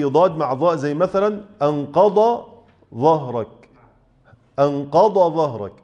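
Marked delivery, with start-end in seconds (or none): 1.6-5.7 أنقض ظهرك with the ض and ظ kept apart; clear dad then zaa. Shown as a demonstration. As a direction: say أنقض ظهرك sounding a distinct ض then a separate ظ. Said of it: "لازم تبين الضاد وبعدها الظاء".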